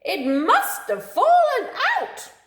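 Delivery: very high, loud screeching; panicky screech. Acted. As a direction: screech it like a frantic bird. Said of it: "screeched Fluster".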